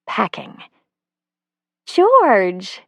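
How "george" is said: called out higher and louder; startled at finding him; surprised. A narrator's reading. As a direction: caught off guard by him.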